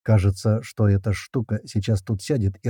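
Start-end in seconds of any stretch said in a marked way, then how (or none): none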